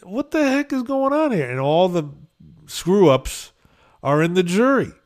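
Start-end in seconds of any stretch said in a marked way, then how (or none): 0.1-1.5 high, loud mock disbelief; hammed-up high-pitched disbelief; mock surprise. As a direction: act out baffled amazement. Retold amazed inner reaction.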